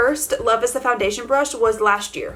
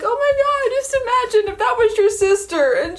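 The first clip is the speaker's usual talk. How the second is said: high, excited gush about the sisters' reunion; retelling her own teary reaction, high and excited; emotional gush; 8 semitones higher than usual. Retold or imitated. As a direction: gush like you're close to tears. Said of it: "And I'm just like".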